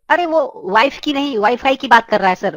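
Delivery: normal talking voice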